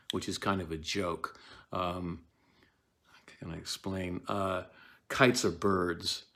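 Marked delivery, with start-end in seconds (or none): none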